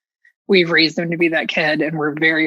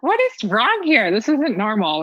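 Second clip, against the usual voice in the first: put-on alarmed young self; 6 semitones higher than usual.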